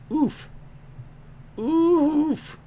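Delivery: high, stretched oof; oof on request. Acted. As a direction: give a long, high oof. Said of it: "Can I get an oof?".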